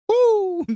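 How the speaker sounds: sudden high, loud "woo"; imitated tic. Hider with a tic during zombies, sudden whoop. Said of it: "The ones that got that Tourette's tick".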